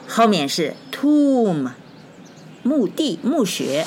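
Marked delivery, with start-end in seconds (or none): 0.9-1.7 tomb stretched long, ending on M; higher, slow model of tomb; silent B. Shown as a demonstration. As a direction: draw out tomb, no final b. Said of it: "但是B不发音".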